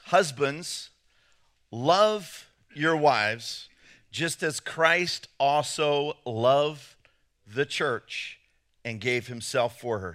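slow, measured Bible reading; scripture reading. Acted. Pastor reading Ephesians to husbands, slow scripture reading. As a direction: read the verse phrase by phrase, with weight.

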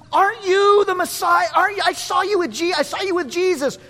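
put-on high-pitched, insistent child voice; little girl accusing. Little girl spotting Peter, high insistent accusation. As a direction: blurt the accusation like an excited child. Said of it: "A little girl walks up to him".

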